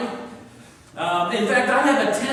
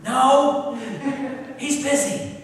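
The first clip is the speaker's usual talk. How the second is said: put-on small child; 4 semitones higher, slower than usual. Child answers boss.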